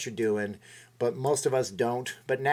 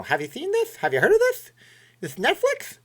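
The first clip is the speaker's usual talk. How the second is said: much higher, louder mock Jay Leno; bad Jay Leno; 5 dB louder than usual. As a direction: ham up a talk-show host's gag question. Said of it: "That's my bad Jay Leno impression".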